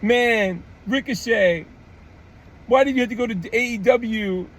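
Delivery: high, whiny complaint; whiny girl fan. Retold or imitated. Vlogger imitating a girl complaining online, whiny. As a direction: whine like a disappointed fan. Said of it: "this girl goes".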